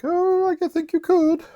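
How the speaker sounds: high swooning lady's voice; Olivia voice. Acted. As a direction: coo it like a lovesick countess. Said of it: "I do this voice for Olivia".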